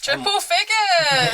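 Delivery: high, drawn-out celebratory call; triumphant cheer. Real, not acted. Player celebrating a huge dice score to the table, triumphant cheer. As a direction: stretch it out in triumph.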